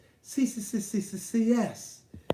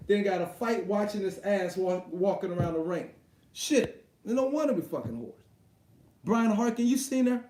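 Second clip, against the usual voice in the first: ordinary talk, nothing marked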